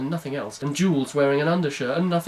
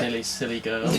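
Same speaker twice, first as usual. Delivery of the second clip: low mocking murmur; 4 semitones lower, 6 dB quieter than usual. Joins in mocking the character.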